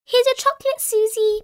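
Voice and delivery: put-on high, loud child voice; Peppa Pig. A narrator's reading. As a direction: pipe it out to a friend. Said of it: "Peppa said".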